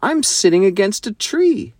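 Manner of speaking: put-on high, amazed child character; surprised and high-pitched; astonished discovery. A narrator's reading. Startling discovery in the wardrobe.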